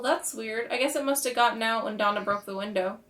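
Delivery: ordinary delivery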